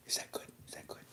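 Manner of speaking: barely audible whisper; hushed whisper out of character; whispered aside. Real, not acted. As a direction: check quietly, under the breath. Aside checking own performance.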